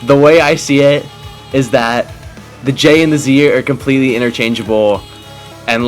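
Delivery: usual speaking voice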